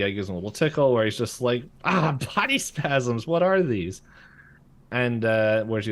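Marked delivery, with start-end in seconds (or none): 1.8-4.0 high-pitched startled outcry, acted; mock alarm. Bill tickled in Dipper's body, mock alarm. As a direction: imitate someone baffled by being tickled.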